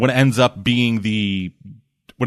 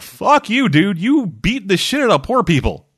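much higher-pitched indignant scolding; mock indignant retort; 9 semitones higher than usual. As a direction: act out an outraged telling-off. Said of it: "And Luke's like".

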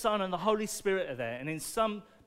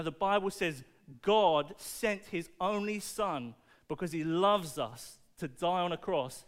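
ordinary talk

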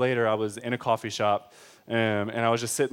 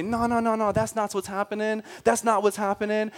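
high, insistent minister's denial; higher-pitched, mock ministerial protest; denying minister; 9 semitones higher than usual. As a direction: wave it away in a raised pitch. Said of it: "then the minister can come and say".

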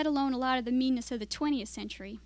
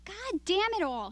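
slow, higher, restrained curse; unaggressive anger; 8 semitones higher, slower than usual. A narrator's reading. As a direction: let the anger leak out without raising your voice. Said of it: "the man was very angry".

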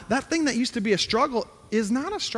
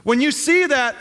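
normal talking voice

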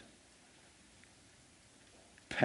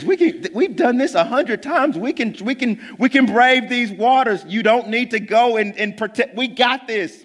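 high, louder hammed-up fisherman boasting; mock eager bravado; 8 semitones higher, 5 dB louder than usual. Disciples insisting to Jesus they can brave waters, hammed-up high boasting. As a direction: oversell it like cocky sailors. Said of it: "and they said".

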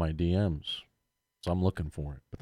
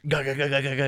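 mock gunfire noises; 7 semitones higher than usual. Imitating game gunfire.

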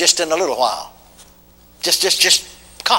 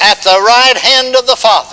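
preaching call; 6 semitones higher, 6 dB louder than usual. Acted. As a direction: chant it out line by line. Call and response.

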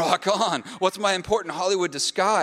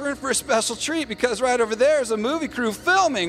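excited announcing; 8 semitones higher than usual. Acted. Tour guide announcing.

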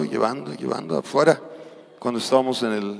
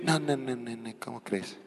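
mock refusal; 10 dB quieter than usual. Mocking humble refusal.

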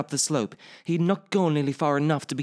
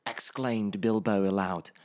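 usual speaking voice

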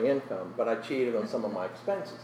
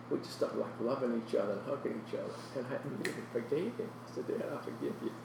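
soft, tearful telling; hushed and choked up; close to crying; 8 dB quieter, faster than usual. Real, not acted. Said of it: "I'm gonna cry".

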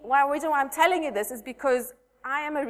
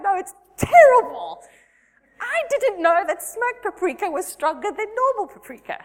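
1 mock tearful wail; 8 semitones higher, 8 dB louder than usual. Retold crying over the ruined dish.

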